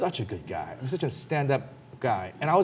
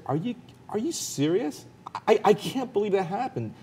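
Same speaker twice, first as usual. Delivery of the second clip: a mild, much higher, incredulous gasp of a question; retold disbelief; 9 semitones higher than usual. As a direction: lightly replay your shocked reaction. Disbelief at friend's story.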